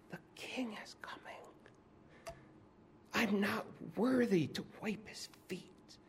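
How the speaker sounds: hushed, awed whisper of the unborn John. Retold or imitated. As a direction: breathe it out softly, as if hidden in the womb. Unborn John voiced in womb.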